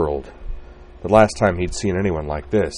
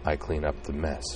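softly; 8 dB quieter than usual. Acted. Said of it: "said Corwin softly".

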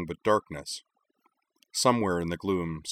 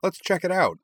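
put-on high, eager kid voice; bursting to explore; eager child voice; 11 semitones higher, 4 dB louder than usual. Eager boy wants to explore.

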